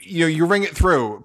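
usual speaking voice

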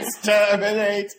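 slow Dalek-style robot voice. Acted. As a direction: grind out "Exterminate" like a Dalek. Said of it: "you sound like a robot".

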